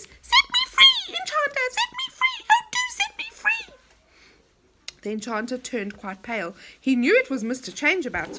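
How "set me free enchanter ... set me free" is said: very high squeaky plea from the kettle; small squealing voice. Acted. Tiny voice in the kettle begging the enchanter, very high squeak. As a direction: squeal to be let out. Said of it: "a small squealing voice".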